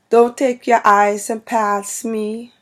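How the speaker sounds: loud, slow Guyanese parent's Caribbean accent. Shown as a demonstration. Vlogger imitating Guyanese parents' sayings, loud Caribbean accent. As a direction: scold slowly and loudly in a Caribbean accent. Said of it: "my parents have that accent".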